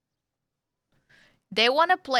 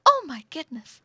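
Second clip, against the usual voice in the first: clueless; 7 semitones higher, 7 dB louder than usual. Shown as a demonstration. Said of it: "She didn't listen to anything".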